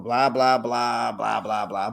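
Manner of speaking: low, monotone blah-blah; dismissive droning. Real, not acted. Vlogger skipping the dull part of a recap, dismissive drone. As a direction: drone through it like filler.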